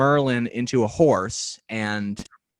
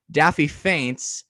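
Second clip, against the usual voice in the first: usual speaking voice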